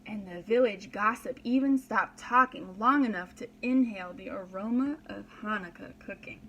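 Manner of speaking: usual speaking voice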